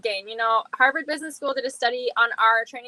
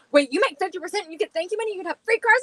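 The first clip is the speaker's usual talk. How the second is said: fast, high, eager imitation; quick high mock excitement; husband's excited questions; 6 semitones higher, faster than usual. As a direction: rattle it off in excited disbelief. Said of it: "He was like".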